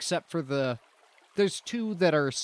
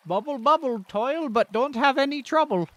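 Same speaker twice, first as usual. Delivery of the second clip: sing-song NPC; 9 semitones higher, 5 dB louder than usual. Acted. GM voices a rhyming NPC.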